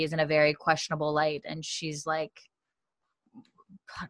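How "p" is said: ordinary talk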